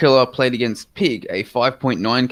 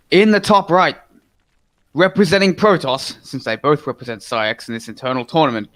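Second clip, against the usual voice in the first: caster intro; 4 semitones higher than usual. Acted. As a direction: introduce the players like the match is starting.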